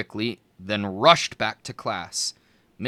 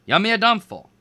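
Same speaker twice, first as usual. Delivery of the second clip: higher, louder attempt; louder retry; 9 semitones higher, 8 dB louder than usual. A narrator's reading. Boy trying the spell again, louder. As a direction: push the spell harder.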